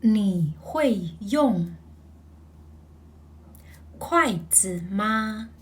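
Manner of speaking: very slow, syllable by syllable; slow model reading of 你会用筷子吗; slow read-along. Shown as a demonstration. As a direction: read it slowly together. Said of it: "let's read the sentence together".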